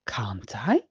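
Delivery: hushed unvoiced whisper; whispering so the guests won't hear; whispered question. A narrator's reading. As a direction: whisper it back sheepishly. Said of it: "asks Peppa".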